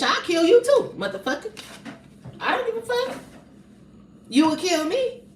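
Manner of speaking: heated threat. Real, not acted. Threatening anyone near her kids.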